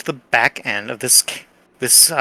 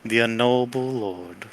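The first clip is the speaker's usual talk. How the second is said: eerie plant chorus. Acted. Plants name their queen.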